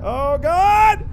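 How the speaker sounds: high, loud cry rising to a scream; real frightened shout; terrified. Real, not acted. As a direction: cry out in sudden terror. Jump scare.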